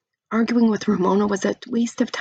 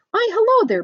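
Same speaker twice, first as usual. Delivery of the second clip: much higher, louder gushing hello; sugary neighbor greeting; 6 dB louder than usual. A narrator's reading. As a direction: gush over the children. Neighbor greets children sweetly.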